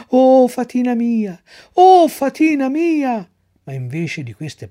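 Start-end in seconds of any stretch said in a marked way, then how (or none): 0.1-1.4 loud, higher, slow joyful cry. Acted. Pinocchio calls to his fairy from the ring, loud. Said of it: "si provò a gridare". 1.7-3.3 even higher, louder, slow straining call; louder cry. Acted. Pinocchio calls again to the fairy, straining and louder. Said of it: "si provò a gridare".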